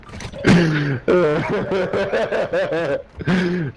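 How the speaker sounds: real amused laughing fit; long, genuine laugh; laughter. Real, not acted. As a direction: laugh on and on at a friend's trash talk. Laughing at friend's insults.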